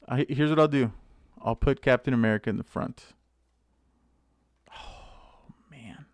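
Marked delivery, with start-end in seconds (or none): 4.7-6.1 whispered disappointment. Real, not acted. Disappointed under his breath.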